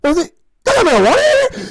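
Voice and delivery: high, loud incredulous quote; mock astonishment. Retelling the hospital janitor scene, high loud astonished question. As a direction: raise the voice sharply in disbelief. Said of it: "He's like".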